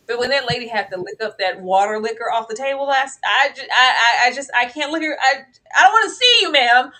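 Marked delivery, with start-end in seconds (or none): 5.2-6.9 loud dismissal. Acted.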